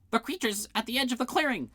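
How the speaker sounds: high-pitched warning cry; shouting. A narrator's reading. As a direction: cry out an alarm. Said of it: "shouted Blim".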